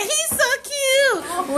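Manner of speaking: high, slow, swooning imitation of her; mocking girly voice. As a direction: drag out the gushing like a smitten girl. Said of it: "She said".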